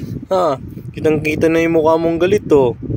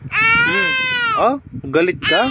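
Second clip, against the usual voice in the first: put-on much higher, teasing baby-talk voice; squeaky baby talk; slower than usual. Mother teasing her angry baby in baby talk.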